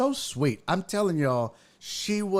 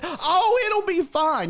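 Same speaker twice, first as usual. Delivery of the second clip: high, louder airy dismissal; breezy oblivious aunt; 14 semitones higher, 4 dB louder than usual. Retold or imitated. Aunt dismisses niece's worry, breezy and oblivious. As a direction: wave it away in a high hoot. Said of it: "That's how she was".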